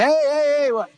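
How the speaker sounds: high mock yell of calling kids; retold kids' yelling. Kids up on a hill yelling to get a man's attention, mock high shout. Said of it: "I hear all this screaming".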